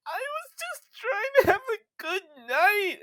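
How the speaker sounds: mock-sob the line like the upset wife; imitating crying wife. Wife tearfully complaining during the sad game, imitated as a high sob. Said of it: "she just starts crying even harder".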